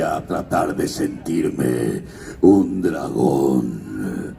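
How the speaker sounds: very low, slow voice from the gut; dragon voice. Shown as a demonstration. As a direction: speak like a fire-breathing dragon. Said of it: "¿Ven cómo va cambiando la voz?".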